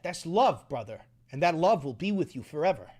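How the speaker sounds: normal talking voice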